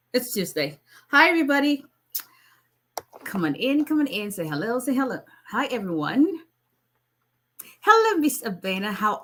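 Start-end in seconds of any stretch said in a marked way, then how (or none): none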